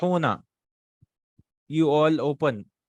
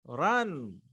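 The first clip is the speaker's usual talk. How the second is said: ordinary talk, nothing marked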